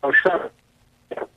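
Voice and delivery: normal talking voice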